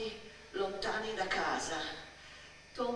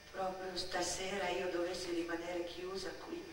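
usual speaking voice

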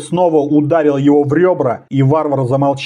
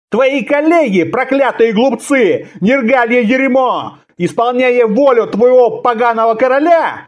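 furious tirade; 8 semitones higher than usual. A narrator's reading. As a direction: hurl insults at your captors. Captive Conan insults his captors.

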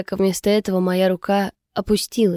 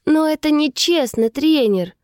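higher-pitched objection; insistent protest; 7 semitones higher than usual. A narrator's reading. April won't back down before the coach, insistent protest. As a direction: protest the unfairness, pitch raised. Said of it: "всё не отступал Эйприл".

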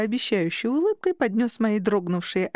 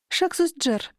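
light, careless aside in a higher pitch; offhand explanation; 8 semitones higher, slower than usual. A narrator's reading. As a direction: toss it off casually, pitched up. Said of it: "пояснила небрежно".

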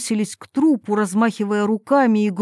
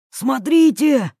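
higher, drawn-out shout; excited call; 5 semitones higher, 4 dB louder, slower than usual. A narrator's reading. Yuri stopping suddenly to show Irina, excited call. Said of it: "закричал он".

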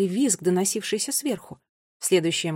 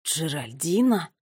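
slow, lower puzzled question; 4 semitones lower, slower than usual. A narrator's reading. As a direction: draw the name out, baffled. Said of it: "озадаченно спросил Финн". (озадаченно, медленно)

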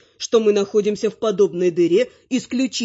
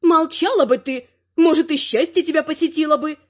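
high-pitched granny retort; granny refusing to let up; persistent bickering granny; 8 semitones higher than usual. A narrator's reading. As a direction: snipe back in a raised old-lady voice. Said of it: "не отставала моя родственница".

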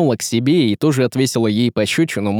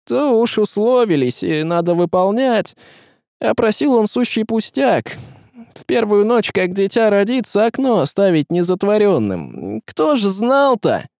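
high, pleading character voice; self-justifying tsar; 10 semitones higher than usual. Acted. Tsar excusing his bargain to his wife, pleading. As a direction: make excuses, pitched well up.

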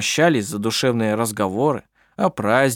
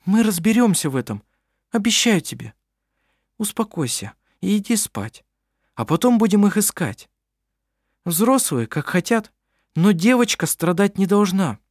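sympathetic; 9 semitones higher than usual. A narrator's reading. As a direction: comfort him like a child. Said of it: "она участливо сказала".

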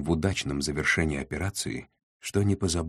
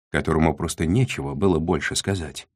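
usual speaking voice

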